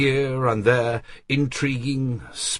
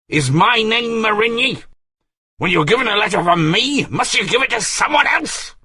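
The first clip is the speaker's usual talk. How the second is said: higher, louder furious rant; shouting; 14 semitones higher, 6 dB louder, faster than usual. Acted. Artois shouts at the shaken servant, furious. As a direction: roar it in a rage. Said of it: "shouting at the top of his voice".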